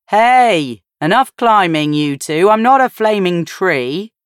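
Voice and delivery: dad telling the twins to stop climbing, mock stern; mock scolding. A narrator's reading. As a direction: jokingly shoo the kids off. Ben tells off twins playfully.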